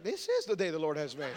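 low, soft, laughing delivery; subdued echo. Acted. Listener echoing a grateful person, low and subdued. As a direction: say it quietly, dropped right down. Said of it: "and you're like".

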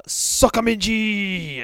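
long, loud, high war whoop; battle cry. Acted. Bear leaping into the vortex, slow loud battle cry. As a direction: hurl the cry out as you leap.

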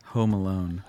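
normal talking voice

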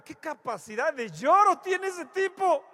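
loud, high, slow incredulous outcry; mock amazement. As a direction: ham up the disbelief at his tears. Mock amazement at crying preacher.

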